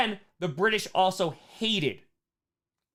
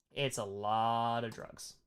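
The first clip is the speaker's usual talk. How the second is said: softer and slower, letting it sink in; hushed repeat; 5 semitones lower, 9 dB quieter, slower than usual. Shown as a demonstration. Teacher repeats the drug point to class, hushed and slow. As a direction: drop the voice for the echo.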